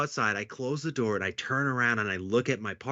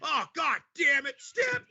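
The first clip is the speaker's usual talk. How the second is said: mock furious shout. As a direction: yell at your buddy for the prank. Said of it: "And then he goes".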